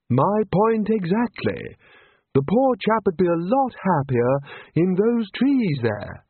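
wizard voice. A narrator's reading. Chair character voice.

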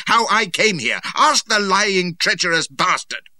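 high, loud denunciation; furious shout. A narrator's reading. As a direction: shout the accusation, hammering the insult. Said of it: "I shouted".

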